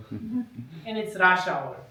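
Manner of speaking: usual speaking voice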